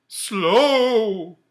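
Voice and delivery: high, loud, drawn-out ghostly cry; ghost's outraged echo. Acted. The ghost echoes Scrooge's word, high and loud. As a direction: fling the word back at him.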